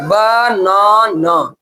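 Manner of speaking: बनाना slowly split as ब... ना... ना; broken into syllables. Shown as a demonstration. Teacher showing class the three breaks, banana syllable by syllable. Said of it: "कितना बार में तोड़ के बोले".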